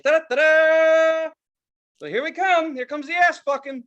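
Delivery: high, loud hammed-up herald; mock fanfare announcement. Mocking streaming services returning ads, a high, loud mock-triumphant herald. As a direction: trumpet it like a grand arrival. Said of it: "And now they're like".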